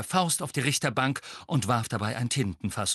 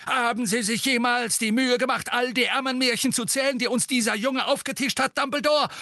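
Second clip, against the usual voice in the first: angry rant; 5 dB louder than usual. A narrator's reading. Fudge ranting.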